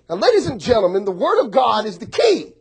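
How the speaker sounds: building sermon emphasis on Word; preaching. Acted. Pastor preaching to congregation, emphatic.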